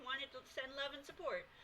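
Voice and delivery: normal talking voice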